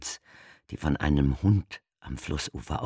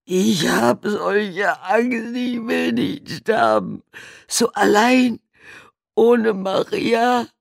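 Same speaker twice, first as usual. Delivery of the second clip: very high, loud, slow wail; terrified sobbing plea; 8 dB louder, slower than usual. A narrator's reading. Buried man confesses his fear, wailing. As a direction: afraid and alone.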